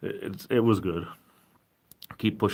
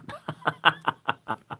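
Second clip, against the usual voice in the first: high chuckling laugh; 7 semitones higher than usual. Real, not acted. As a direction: run of short, high laughs. Laughing at own remark.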